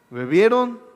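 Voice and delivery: "bebieron" said with a chuckle and a wink; knowing laugh. Shown as a demonstration. Preacher jokes to the church, laughing on "bebieron". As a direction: laugh through "bebieron" as if hinting at wine.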